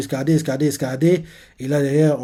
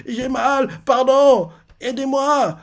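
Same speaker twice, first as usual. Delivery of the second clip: pained crying; 9 semitones higher, much slower than usual. Retold or imitated. Young Kuma crying out as the men beat him. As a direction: cry out in pain and plead.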